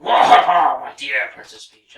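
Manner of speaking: booming villain laugh, then lightly voiced king; half-hearted Bowser impression with a big laugh. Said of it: "I don't even really feel like doing a Bowser voice".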